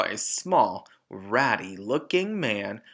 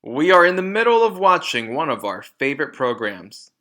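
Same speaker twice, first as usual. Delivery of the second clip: much louder and fast, scornful; sneering loud father; 3 semitones higher, 9 dB louder than usual. Acted. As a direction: fire it off with scorn. Rude father refuses entry.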